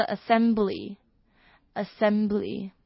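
"assembly" said very slowly with the stress on "-sem-"; drawn-out teacher demo of "assembly"; slow model pronunciation. Shown as a demonstration. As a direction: drill "assembly" for learners. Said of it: "它的名词".

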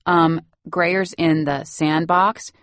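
slow, questioning nosy caregiver. Retold or imitated. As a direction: draw it out like a meddling nanny. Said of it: "some nosy caregiver who's like".

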